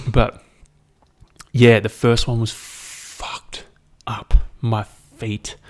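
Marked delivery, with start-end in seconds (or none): 2.5-4.3 slow whisper; slow, hushed whisper. Real, not acted. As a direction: breathe it out like a grim confession. Recalling awful first ice bath.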